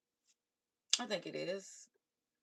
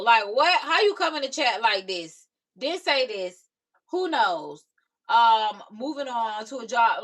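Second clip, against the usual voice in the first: usual speaking voice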